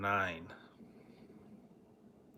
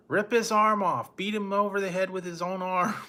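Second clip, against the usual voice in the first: imitated yelling kid; 8 semitones higher than usual. Fan retelling a worked-up kid in the audience, high mock yelling. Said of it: "yelling in the audience".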